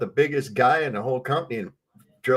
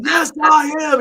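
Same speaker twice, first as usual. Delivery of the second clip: higher, louder yell of a sergeant; 7 dB louder than usual. Retold or imitated. An angry first sergeant yelling at a new private, higher and louder. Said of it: "He starts yelling at me".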